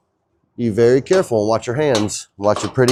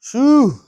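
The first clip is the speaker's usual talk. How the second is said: relieved phew; 13 semitones higher, 3 dB louder than usual. Real, not acted. After sawhorse nearly fell.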